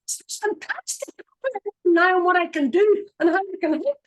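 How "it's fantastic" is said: breathy whispered aside, slow. Real, not acted. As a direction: hush it like a secret joy. Whispered delight after mock pity.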